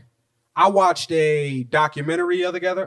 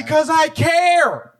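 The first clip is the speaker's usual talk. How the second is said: high, slow, drawn-out holler; mock impassioned yell; 11 semitones higher, 4 dB louder, slower than usual. Answering why he yells, mock impassioned yell. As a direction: wail it out with comic passion. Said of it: "Why are you yelling though?".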